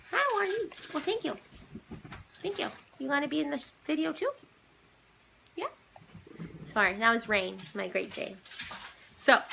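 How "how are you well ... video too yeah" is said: pet talk. Real, not acted. Talking to her Great Dane.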